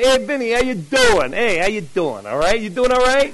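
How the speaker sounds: playful New Jersey street greeting; New Jersey accent. Shown as a demonstration. Greeting relatives back home, New Jersey accent. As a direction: turn on a little New Jersey. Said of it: "turn on a little New Jersey".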